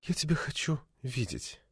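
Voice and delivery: very soft, breathless longing; hushed, intimate yearning. A narrator's reading. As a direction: breathe it out, barely voiced. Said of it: "задохнулась Гаврилова".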